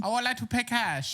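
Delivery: high mock Chinese accent. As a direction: copy the accent back. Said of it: "you match it back to them".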